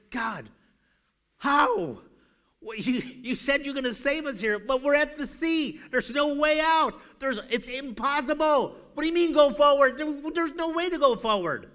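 high, loud, exasperated protest; crying out to God. Acted. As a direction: plead and argue at full voice. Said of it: "Moses crying out to God".

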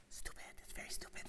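hushed mutter of stupid; whispered aside. Real, not acted. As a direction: say it under your breath to yourself.

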